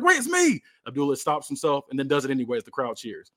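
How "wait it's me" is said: high panicked cry; panicked protest. Acted. Partner grabbed by mistake, high panicked yelp. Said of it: "New Jack is like".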